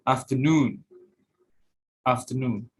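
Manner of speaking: "afternoon" stressed on NOON; final-syllable stress. Shown as a demonstration. Student presenter showing word-final stress, "afternoon" stressed on NOON. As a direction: save the weight for the NOON of "afternoon". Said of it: "And in the end".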